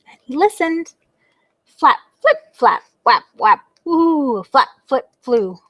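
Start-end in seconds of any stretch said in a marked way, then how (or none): 1.6-3.6 whap them out like wings; flapping sounds. A narrator's reading. Drac's wings flapping in the cave, sharp noises.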